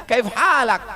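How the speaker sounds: Saudi dialect. Shown as a demonstration. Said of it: "Sampai ke Saudi Arabia".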